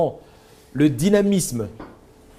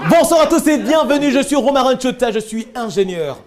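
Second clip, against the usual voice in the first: high, loud and lively, laughing through it; cheerful, pumped-up self introduction to an audience; energetic presenter demo; 7 semitones higher, 3 dB louder than usual. Shown as a demonstration.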